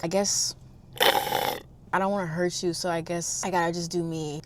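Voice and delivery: soft, mumbly imitation of him; subdued letdown. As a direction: imitate a man letting her down quietly. Retells his letdown next morning.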